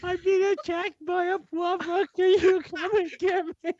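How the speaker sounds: high, whiny put-on voice; mock pitiful plea. Friend mocking Ryan's phone call to Millie, high whiny plea. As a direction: squeak it out like a pathetic victim.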